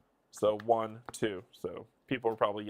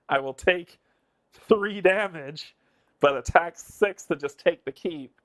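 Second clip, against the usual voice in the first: loud, high, excited call of the move; shouting the play out in excitement; excited yelling; 4 semitones higher, 10 dB louder than usual. Real, not acted. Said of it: "Oh, am I yelling?".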